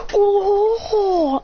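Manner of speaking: high, drawn-out "oh" of pain; pained groan. Retold or imitated. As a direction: groan as if punched in the gut. Acts being punched in gut.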